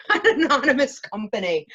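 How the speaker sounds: laughing while speaking. Real, not acted. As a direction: chuckle through the words. Laughing through the word.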